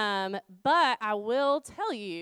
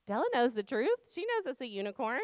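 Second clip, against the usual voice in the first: normal talking voice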